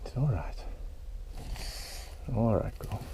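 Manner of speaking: quiet, gentle reassurance; soft soothing to the snake. Real, not acted. Keeper calming the irritated python, soft and quiet. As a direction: murmur to settle a grumpy animal.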